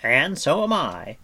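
proud, projected announcement; declaring bard. A narrator's reading. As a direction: declare it boldly as a story bard. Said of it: "Fluder declared".

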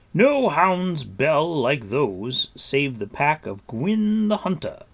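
musing Gwydion. A narrator's reading. Gwydion naming the Hunter's pack to Taran, musing. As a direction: muse aloud in slow, sonorous tones. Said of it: "he mused".

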